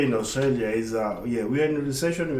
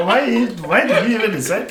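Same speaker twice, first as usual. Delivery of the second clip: laughing; 8 semitones higher, 6 dB louder than usual. Real, not acted. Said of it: "Oh, I just don't miss it".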